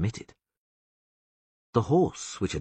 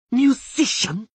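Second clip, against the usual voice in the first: higher, louder scornful question; mock growl; 8 dB louder, slower than usual. A narrator's reading. Taruviel sneering at Dandelion, higher and louder. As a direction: sneer the word. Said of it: "she growled".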